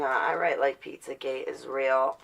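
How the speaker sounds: normal talking voice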